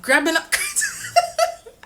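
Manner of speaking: squealed through a laugh, slow; giggling. Real, not acted. Fan recalling grabbing her idol, squealing laughter.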